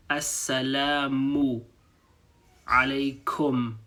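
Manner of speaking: Assalamu alaykum slowly and clearly; slow demo. Shown as a demonstration. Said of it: "So before we said".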